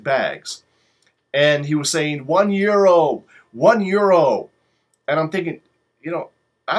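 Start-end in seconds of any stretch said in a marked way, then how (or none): 2.3-4.5 loud sing-song hawker call; vendor calling out. Retold or imitated. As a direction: call it out like a street seller. Street vendor calling out sales.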